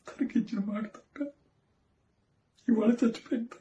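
crumbling into tears while owning half the blame; breaking down, voice climbing and cracking. Acted. Father confessing guilt to judge.

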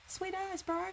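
high, broad Kiwi sweet as bro; broad kiwi accent. Pretend. Kiwi slang reply to viewer.

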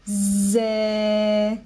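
"Zè" held long with a buzzing z; drawn-out syllable. Shown as a demonstration. Teacher breaking zèbre into syllables, drawn-out "Zè". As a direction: stretch "Zè", lingering on the z and vowel. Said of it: "Alors, on y va".

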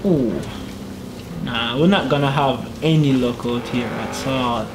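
ordinary talk

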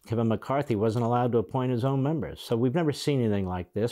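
ordinary delivery

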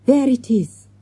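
plain reading voice

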